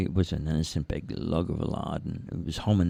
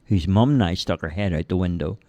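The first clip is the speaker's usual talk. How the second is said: ordinary delivery, nothing marked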